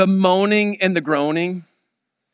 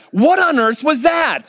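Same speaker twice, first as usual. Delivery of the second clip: raised, high-pitched indignant question; mock-indignant husband, pitch up; irritated; 9 semitones higher than usual. Husband snapping at wife about the massage, irritated and high. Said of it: "He's irritated".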